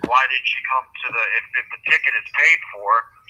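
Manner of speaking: ordinary talk, nothing marked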